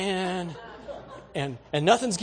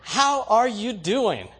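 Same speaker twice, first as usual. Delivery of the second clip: slow, smiling, higher hello; friendly greeting; 6 semitones higher, 6 dB louder, much slower than usual. Shown as a demonstration. Showing the congregation how to greet a stranger, slow and smiling. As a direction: greet them with a smile. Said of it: "smile at them and go".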